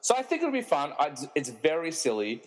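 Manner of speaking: normal talking voice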